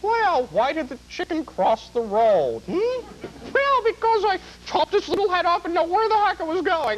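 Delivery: very high, whooping female cook impression; Julia Child. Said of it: "imagine her as a stand-up comedian".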